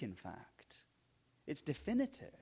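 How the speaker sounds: whispered softly, barely voiced; hushed whisper. Real, not acted. Preacher whispers to the congregation for emphasis, soft whisper. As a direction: drop to a whisper to draw them in.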